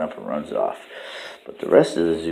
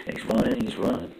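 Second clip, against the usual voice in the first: ordinary delivery, nothing marked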